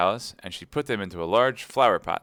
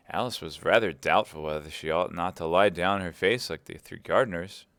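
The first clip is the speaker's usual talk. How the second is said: ordinary delivery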